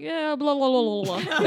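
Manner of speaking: lazy radio sign-off; over a radio. Retold or imitated. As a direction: blah through it like on the radio. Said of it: "Somebody's just like".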